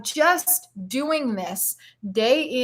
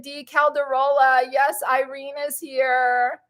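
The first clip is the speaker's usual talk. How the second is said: ordinary talk, nothing marked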